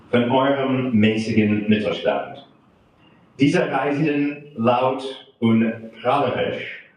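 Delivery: put-on German verse recited with an American accent; poem reading. Translator reads Tucholsky's German satire to an audience, measured poem reading. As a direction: recite in measured phrases, pausing between lines.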